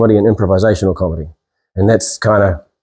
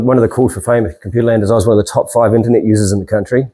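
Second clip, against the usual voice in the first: normal talking voice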